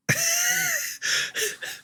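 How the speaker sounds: high, airy laughing fit; breathless amused wheeze; wheezing laugh. Real, not acted. Laughs at own story.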